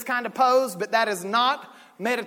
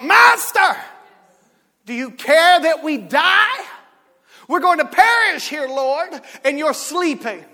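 a touch of panic, higher and louder; panicked disciples; 5 semitones higher, 6 dB louder than usual. Acted. Disciples waking Jesus in the storm, mock panic. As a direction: cry out in mock alarm.

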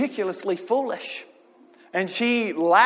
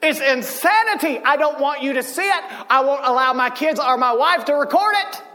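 high, raised-voice preacher's exhortation; excited pulpit delivery, pitched up; fired-up preaching; 7 semitones higher, 3 dB louder than usual. Real, not acted. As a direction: preach it with rising fervor. Preaching at full force.

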